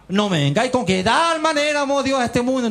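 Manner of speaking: high-pitched, acted protest; head off the stock answer in an exaggerated high voice; retold teacher's impatient objection; mock exasperation. Teacher mimics himself warding off stock verse.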